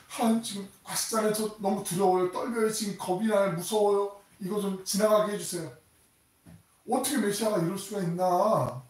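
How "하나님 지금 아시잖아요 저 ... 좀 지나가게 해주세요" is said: fearful pleading. Acted. Jesus pleading in fear.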